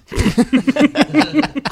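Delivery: high, loud burst of laughter; real laugh, high and loud. Real, not acted. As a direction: laugh out in disbelief. Shocked at Siri's insult.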